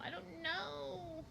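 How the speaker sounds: slow, high and soft, stretched out; high drawn-out dithering. Real, not acted. Streamer dithering over her artwork, high drawn-out uncertainty. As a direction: let it float up high and quiet.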